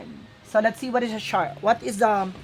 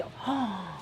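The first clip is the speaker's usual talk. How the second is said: soft gasp; 10 dB quieter than usual. Real, not acted. Gasp at card.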